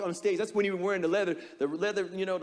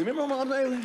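ordinary delivery

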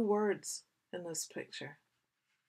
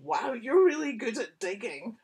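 higher, louder admiring sheep voice; 3 semitones higher, 8 dB louder than usual. Acted. Reader voices sheep, admiring Bess loudly. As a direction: gush with admiration.

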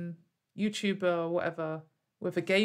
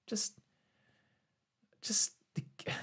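whisper; 8 dB quieter, slower than usual. Real, not acted. Trailing off in an aside.

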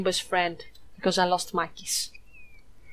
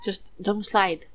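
ordinary delivery

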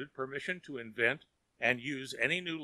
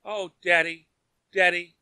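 higher, louder coaxing plea; pleading; 6 semitones higher, 8 dB louder than usual. A narrator's reading. Daughter pleading with father for Tom, pleadingly. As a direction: daughter begging father to relent. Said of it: "she pleaded".

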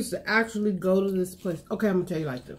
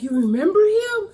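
surprised; 11 semitones higher, 5 dB louder than usual. Retold or imitated. Quoting her question to sister.

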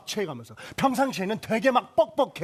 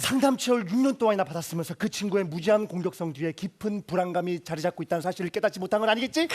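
lower, softer, soothing; calm self-talk; 3 semitones lower, 4 dB quieter than usual. Acted. He calms himself down on the stairs, low and soft. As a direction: talk yourself down gently.

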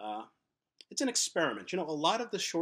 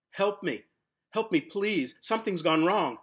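higher, louder desperate cry; acted scream for help; 6 semitones higher, 7 dB louder than usual. Pencil crying out in terror in the sharpener, loud and high. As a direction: plead to be heard over the noise. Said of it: "He screams".

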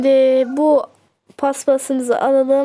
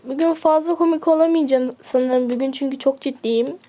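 ordinary delivery

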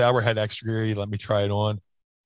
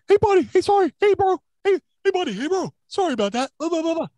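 much higher meathead bro, apologetic; fake-sorry gym bro patter; Bruiser Bedlam imitation; faster than usual. Bruiser apologizing backstage after the chair shot, high bro voice. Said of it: "Johnny Canine quoted, same Bruiser voice".

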